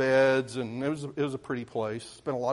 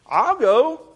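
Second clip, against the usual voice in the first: eager; 11 semitones higher, 10 dB louder than usual. Retold or imitated. Boy eagerly volunteering.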